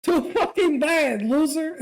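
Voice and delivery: loud, high, drawn-out jeer; spring the cruel punchline with a laugh in it; slow, loud mocking put-down; gleeful taunt.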